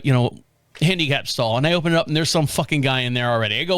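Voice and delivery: ordinary talk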